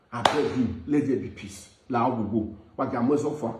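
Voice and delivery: calm them down gently; soft pleading. Real, not acted. Peacemaker soothing the angry mama, soft pleading.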